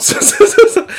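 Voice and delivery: breaks into a high laugh on the yeses; laughing along, agreeing hard. Real, not acted. Laughing while agreeing with the co-host.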